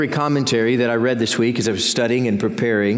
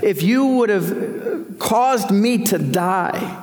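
raised, pleading voice of a suffering man; a hint of anguished Job, higher and pleading; voicing Job's lament; 9 semitones higher than usual. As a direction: cry out to God as Job, pitched up high. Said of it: "He says".

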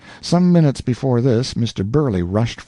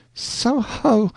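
high startled "So ho!"; a high, surprised cry; surprised exclaim; 14 semitones higher than usual. A narrator's reading. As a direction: blurt it out, caught off guard. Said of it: "exclaimed Missus Fitzclarence".

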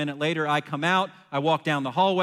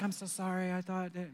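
soft, higher-pitched imitation of a wife; apologetic wife; 4 semitones higher, 10 dB quieter than usual. Wife apologizes to husband over the duck, softly and higher. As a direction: apologize in a small, hushed, raised voice. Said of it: "My wife's like".

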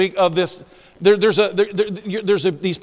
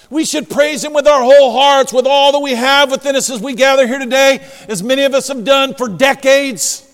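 raised, emphatic pulpit proclamation; forceful preaching; 6 semitones higher, 8 dB louder than usual. Acted.

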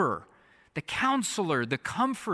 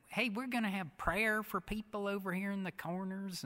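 put-on southern accent; 7 semitones higher, 5 dB quieter than usual. Bishop calling people up for prayer, imitated southern accent. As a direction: lightly mimic a Southern preacher's accent. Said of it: "southern accent. He said".

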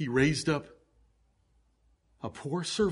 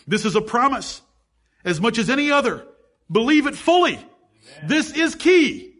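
much higher, louder, emphatic preaching; full-force preaching; 9 semitones higher, 7 dB louder than usual. Acted. Preacher pressing doubters to believe, full-force preaching. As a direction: drive the promise home with fire.

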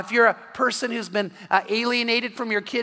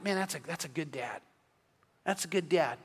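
quiet admiration; 4 semitones lower, 10 dB quieter than usual. Retold or imitated. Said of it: "I thought".

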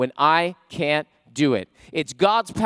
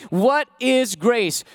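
normal talking voice

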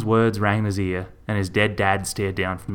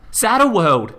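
high, loud furious yell; 12 semitones higher, 6 dB louder, slower than usual. A narrator's reading. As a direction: bellow the shop's name at him. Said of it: "she yelled".

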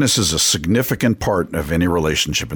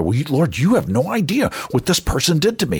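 hurt protest; 10 semitones higher than usual. Acted. Acting a hurt believer protesting to God.

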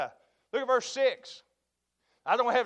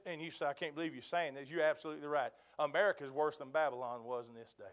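much softer and lower; low confiding; 7 semitones lower, 12 dB quieter, faster than usual. Acted. Preacher confiding America's sin, soft and low. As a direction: lean in and say it quietly. Confiding hard truth to congregation.

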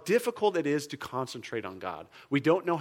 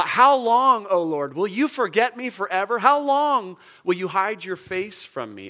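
psalm reading; 6 semitones higher, 7 dB louder than usual. Shown as a demonstration. Reading the psalm to the congregation in a pleading lament. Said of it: "Here's Psalm thirteen".

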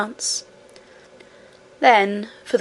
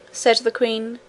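usual speaking voice

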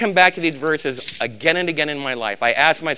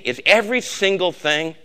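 normal talking voice

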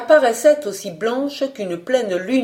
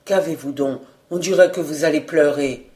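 usual speaking voice

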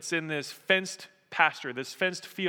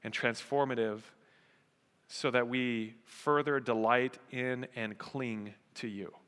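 prayer; 6 semitones lower, 5 dB quieter than usual. Real, not acted. Pastor closing a prayer before the sermon, hushed and measured.

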